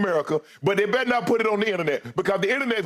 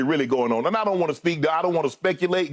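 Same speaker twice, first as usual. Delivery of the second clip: ordinary talk, nothing marked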